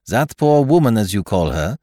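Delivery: French detective quoting back, "woman" falling; French-accented echo. Acted. Poirot echoes companion's words.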